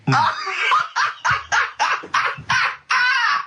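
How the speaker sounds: squealing high laughter. Real, not acted. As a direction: let out a high helpless laugh. Laughing at Carl.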